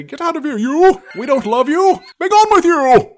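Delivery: loud, high, hammy shouting; barbarian. Retold or imitated. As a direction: bellow him out of the ring. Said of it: "he would say".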